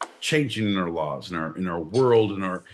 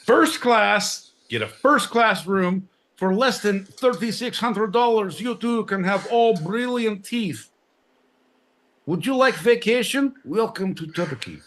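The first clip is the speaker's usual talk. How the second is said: high, bright salesman pitch for Turkey; mock travel ad; 11 semitones higher than usual. [name] hawking Turkish dental trips in mock ad voice. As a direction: sell dental tourism like a cheesy ad.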